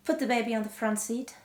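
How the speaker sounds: plain reading voice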